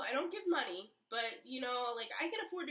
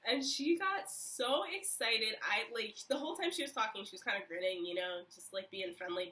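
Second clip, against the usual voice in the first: ordinary talk, nothing marked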